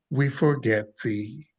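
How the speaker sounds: normal talking voice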